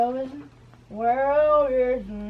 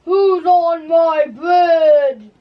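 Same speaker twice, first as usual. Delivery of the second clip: slow, loud monster roar; troll-like dragon bellowing, slow and loud; dragon shout; 3 semitones higher, 7 dB louder, slower than usual. Acted. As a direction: bellow it down at the intruder. Dragon challenges on bridge.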